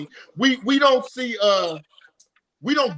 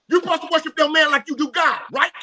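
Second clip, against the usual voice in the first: higher, louder, worked up; heated rant; 7 semitones higher, 3 dB louder than usual. Real, not acted. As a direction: preach it hard.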